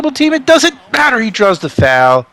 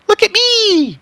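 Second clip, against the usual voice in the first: "Look at me" pitched much higher, playful brag; mock showoff player; slower than usual. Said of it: "fun if a basketball player said, like".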